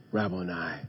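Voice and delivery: very soft and low, full of reverence; hushed tender. Acted. Mary answering Jesus at the tomb, softly and low. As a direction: breathe it out like a whispered name of love.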